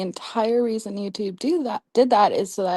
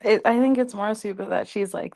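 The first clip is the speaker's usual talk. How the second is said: ordinary talk, nothing marked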